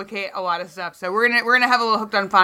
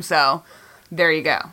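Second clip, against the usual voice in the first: ordinary delivery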